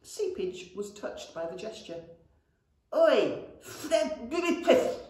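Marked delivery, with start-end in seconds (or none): 2.9-5.0 high, loud, spitty call; dribbling goblin. A narrator's reading. Goblin calls to brother, dribbling. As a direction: call out through a big tooth. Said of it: "he dribbled".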